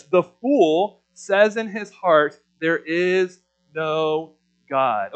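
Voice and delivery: slow and weighty, each word given space; slow scripture reading. Shown as a demonstration. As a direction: lay the verse down slowly and solemnly. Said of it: "reads the verse slowly".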